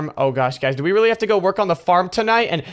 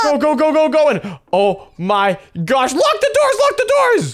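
high frantic shouting; panicked yelling; 6 semitones higher, slower than usual. Acted. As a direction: yell to lock the doors. Urging others to lock doors.

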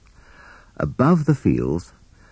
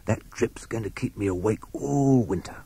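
softer, hissed snake voice; softer sibilant snake grumbling; hissing snake; 3 semitones higher, 7 dB quieter than usual. A narrator's reading. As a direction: hiss it out like a sly snake. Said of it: "hissed Sid".

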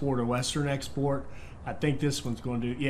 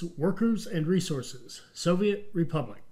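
intro title read; 4 semitones higher than usual. Acted.

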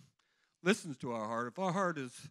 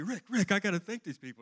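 urgent plea; 7 semitones higher, 7 dB louder than usual. Retold or imitated. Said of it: "I'm going".

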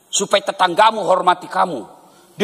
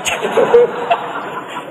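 airy giggle. Retold or imitated. Wife laughs.

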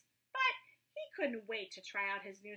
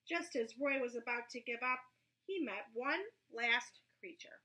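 ordinary delivery

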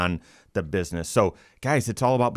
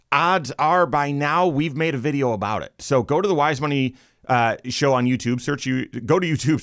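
energetic show-opening patter, pitched up; radio host read; 3 semitones higher than usual. Acted. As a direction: drive the segment intro along like a radio host.

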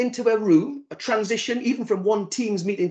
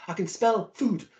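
ordinary talk, nothing marked

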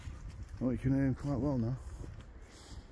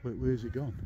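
usual speaking voice

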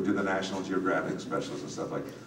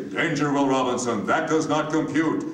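higher, louder, rigid machine voice on each word; stiff mechanical robot; 6 semitones higher, 5 dB louder than usual. Retold or imitated. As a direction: clip every word out like a machine. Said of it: "mechanical, stiff, robotian manner".

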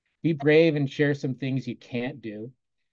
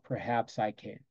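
soft, slow hushed self-talk; a soft private murmur; whispered to oneself; 3 semitones lower, 8 dB quieter, slower than usual. Shown as a demonstration. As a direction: say it quietly to yourself, slowly. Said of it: "whisper to yourself".

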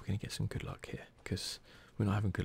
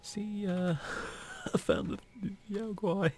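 higher-pitched, louder, laughing yelp; amused alarm; 10 semitones higher, 3 dB louder, slower than usual. Real, not acted. Player fleeing the Yao Guai he found, amused alarm. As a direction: flee from the beast with a nervous laugh. Startled flight from a game creature.

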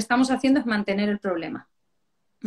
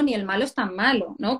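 ordinary talk

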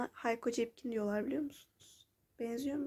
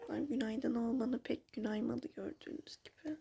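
hushed morning whisper; 8 dB quieter than usual. Real, not acted. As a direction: whisper it close to mic. Morning talk, eye infected.

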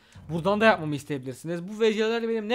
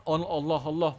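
usual speaking voice

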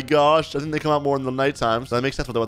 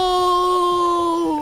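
long, very high wailing yell; dramatic drawn-out cry; screamed denial; 4 dB louder than usual. Retold or imitated. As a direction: stretch it out as a hammed-up scream. Friend's cry during ghost fight.

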